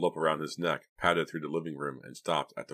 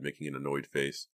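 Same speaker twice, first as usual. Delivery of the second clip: usual speaking voice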